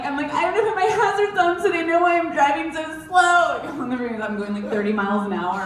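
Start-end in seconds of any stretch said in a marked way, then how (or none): 0.3-3.6 panicked. Retold or imitated.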